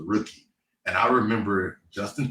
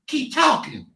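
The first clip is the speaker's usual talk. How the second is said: high squeaky taunt; high-pitched voice; slower than usual. Retold or imitated. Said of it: "the most high-pitched voice that you've ever heard".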